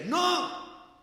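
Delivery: high, loud, slow declaration; preacher retelling his loud faith to scoffers; firm shout of faith. Retold or imitated. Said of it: "Yo siempre decía".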